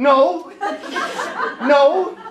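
mock teenager snapping no with a laugh; defiant teen denial. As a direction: toss off a bratty denial. Teen denies drug problem.

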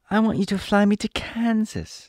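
put-on high little-girl voice; Dorothy, eager. Dorothy asks the Monkey King for a ride, high eager voice. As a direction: pitch it up as a wishing girl.

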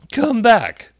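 high, loud call after her. A narrator's reading. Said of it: "the caterpillar called after her".

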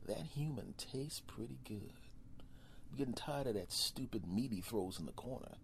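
a hint of a lion eyeing its owner; hungry lion. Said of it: "to the lion going".

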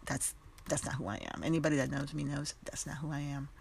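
ordinary talk

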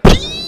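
piercing, very high, loud squeal; long ear-splitting mock child scream; child's waking shriek. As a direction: shriek like an overexcited kid at dawn. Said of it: "When they wake up, they're like".

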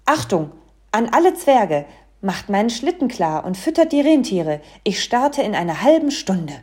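loud call to everyone; loudspeaker announcement. A narrator's reading. Santa orders all dwarfs to prepare, loud announcement. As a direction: bark out orders loudly over the tannoy. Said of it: "einem Lautsprecher und rief".

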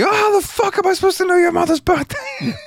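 screechy, very high mock outburst; exasperated skit homeowner. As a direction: blow up in comic frustration, voice leaping way up. Frustrated outburst inside retold comedy skit.

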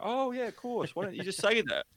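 high-pitched act of the penny dropping; put-on "now I get it" voice, high; mock sudden realisation. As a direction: imitate someone who suddenly gets it, pitch jumping up. Listeners' sudden understanding acted out.

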